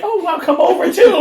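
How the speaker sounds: high, eager invitation; mock eager texter. Imitating a texter inviting her over, high and eager. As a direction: sell it like an overeager stranger. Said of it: "Red is when they like".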